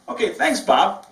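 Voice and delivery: high, bright thanks with a smile; smiling thanks. Shown as a demonstration. Receptionist thanks caller Bob again, smiling. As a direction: smile through the words. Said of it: "and smile".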